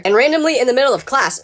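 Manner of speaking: ordinary talk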